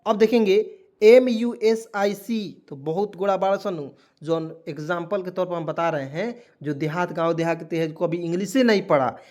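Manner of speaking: usual speaking voice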